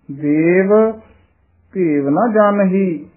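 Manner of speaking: ਦੇਵ held apart, then ਭੇਵ ਨਾ ਜਾਣਹੀ slowly; with a yamak break. Shown as a demonstration. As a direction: pause after ਦੇਵ, with rhyme stress on ਭੇਵ. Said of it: "ਇਹ ਯਮਕ ਲਾ ਕੇ ਉਚਾਰਨ ਕਰਨਾ ਹੈ".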